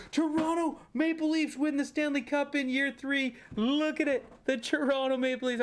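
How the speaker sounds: thrilled fan shouting the result; excited. Real, not acted. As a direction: cheer the win as big news. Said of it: "I'm excited about that".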